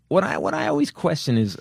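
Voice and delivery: ordinary delivery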